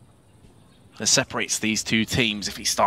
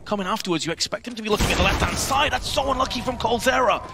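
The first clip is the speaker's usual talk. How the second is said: rising excited play-by-play, higher; esports caster; 8 semitones higher than usual. Real, not acted. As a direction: call every shot as it lands. Live play-by-play of a close round.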